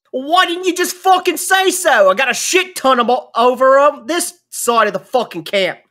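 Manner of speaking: high, loud angry camp boss yelling back; gruff NPC shouting. Acted. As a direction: bark it out like an irate old tinkerer.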